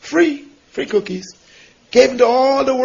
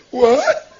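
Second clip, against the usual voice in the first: a squealing, much higher "What?"; shocked what. Retold or imitated. Lady told to give away cookies, squealing in disbelief. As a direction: yelp it in disbelief.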